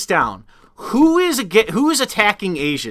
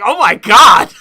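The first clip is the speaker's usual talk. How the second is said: loud, raised-pitch mock astonishment; mock shock; 4 semitones higher, 7 dB louder than usual. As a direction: exclaim it in put-on disbelief. Mock reaction to voting figures.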